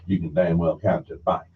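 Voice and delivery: ordinary talk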